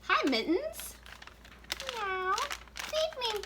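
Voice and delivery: very high, meowing puppet cat voice. Pretend. Host voicing cat puppet Mittens to viewers, very high puppet voice.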